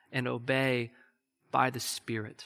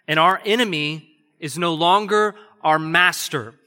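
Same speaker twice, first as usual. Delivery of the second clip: preaching at full force; 3 semitones higher, 6 dB louder than usual. Real, not acted.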